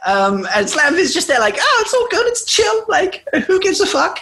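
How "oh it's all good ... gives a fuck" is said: high-pitched mock carefree voice; hammed-up chilled-out character; breezily unconcerned. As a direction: wave it all away in a put-on high voice. Acts out a carefree woman.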